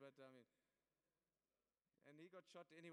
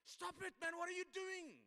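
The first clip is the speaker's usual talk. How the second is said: full-force angry shout, much higher; shouted intervention; 11 semitones higher, 15 dB louder, slower than usual. Retold or imitated. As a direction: yell out the window to make them stop. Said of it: "I just shouted".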